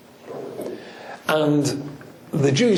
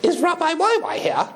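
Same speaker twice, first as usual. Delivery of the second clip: very high-pitched royal impression; Queen of England. Asking the community president after the rabbi, high mock-royal voice. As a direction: squeal it in a put-on regal English voice. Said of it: "and she said".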